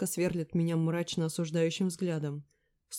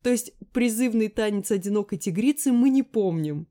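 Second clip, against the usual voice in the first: loud, higher sly teasing; snide; 5 semitones higher, 9 dB louder than usual. A narrator's reading. Natasha mocking Lera about the dance, snide tease. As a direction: needle her with it. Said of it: "ехидно добавила".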